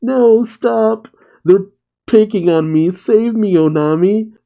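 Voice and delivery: high-pitched mock plea; pleading for help. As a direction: imitate a kid begging to be saved. Acted child pleading for rescue.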